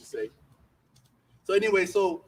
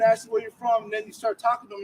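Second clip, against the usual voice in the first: usual speaking voice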